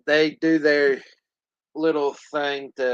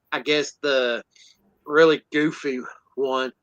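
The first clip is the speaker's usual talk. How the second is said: ordinary talk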